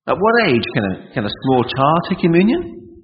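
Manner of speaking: ordinary delivery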